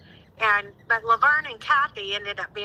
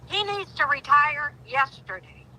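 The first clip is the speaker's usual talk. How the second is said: outraged; 6 semitones higher than usual. Real, not acted. Outraged rant about the sheriff.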